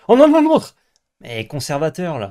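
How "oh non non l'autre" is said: high, very loud cry of protest; sudden loud, pitched-up dismay; alarmed shout. Real, not acted. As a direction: shout to stop a mistake. Alarm at teammate's play.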